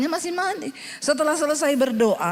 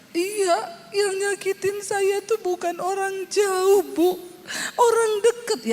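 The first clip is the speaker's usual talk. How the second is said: whiny hurt woman; 6 semitones higher, slower than usual. Retold or imitated. Menirukan ibu yang sakit hati.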